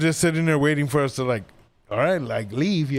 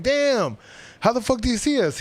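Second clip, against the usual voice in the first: amazed disbelief; 8 semitones higher than usual. Retold or imitated. Friend spots them from far away.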